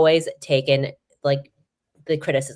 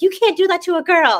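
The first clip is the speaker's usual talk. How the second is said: high, slightly louder playful telling-off; mock scolding; 4 dB louder than usual. [name] reaming Malik for blocking reporter, high mock scolding. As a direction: scold him for her, pitched way up.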